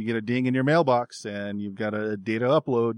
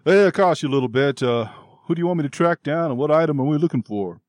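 loud, raised, put-on manly voice; masculine fairy voice; 5 semitones higher, 6 dB louder than usual. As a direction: talk business loudly as a manly little fairy. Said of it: "a more masculine voice comes across".

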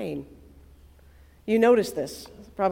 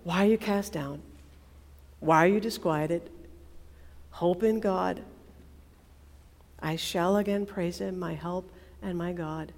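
softer, inward recital; talking to himself; 4 semitones lower, 6 dB quieter than usual. Acted. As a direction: say it under your breath to yourself. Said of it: "like he's talking to himself".

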